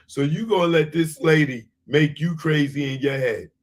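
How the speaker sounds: ordinary talk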